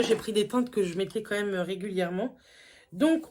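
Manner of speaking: ordinary talk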